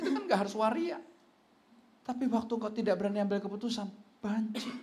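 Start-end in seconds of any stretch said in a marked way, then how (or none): none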